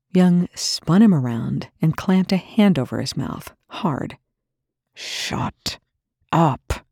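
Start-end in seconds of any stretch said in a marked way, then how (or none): 4.9-6.9 spoke quietly. Acted. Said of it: "he spoke quietly".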